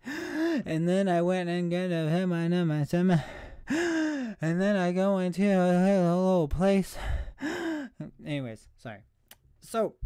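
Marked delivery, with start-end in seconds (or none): 0.0-7.0 breathless gasping mumble rap; mumble rapper. Pretend. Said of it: "I almost sounded like a mumble rapper". 7.0-8.2 sharp high gasp for air; loud gasp. Real, not acted. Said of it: "'cause I'm gasping for air".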